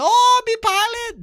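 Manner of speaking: very high, loud drawn-out squeal; imitated excited lady. Streamer reenacting the lady from a crazy video, loud high mock squeal. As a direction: stretch it into a loud falsetto mimicry. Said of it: "then the lady's like".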